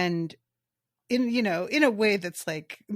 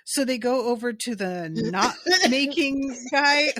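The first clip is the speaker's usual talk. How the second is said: normal talking voice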